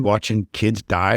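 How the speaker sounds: ordinary talk, nothing marked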